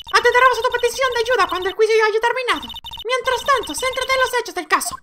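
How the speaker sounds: very high put-on courtroom voice; haughty prosecutor. Prosecutor calls the next witness, high-pitched and formal.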